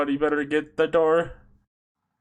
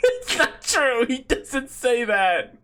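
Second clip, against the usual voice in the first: laughing; 7 semitones higher, slower than usual. Real, not acted. Laughing protest.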